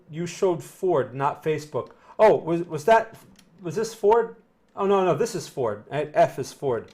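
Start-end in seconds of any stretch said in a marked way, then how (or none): none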